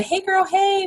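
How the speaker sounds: very high, put-on bubbly voice; mock a bubbly girly hello; gushy girlfriend greeting. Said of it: "It's not how I talk".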